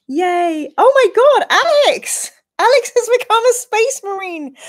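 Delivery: high, loud thrilled shriek; excited shriek. Real, not acted. Streamer welcoming Alex's new membership, shrieking high and loud. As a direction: shriek with delight at the news.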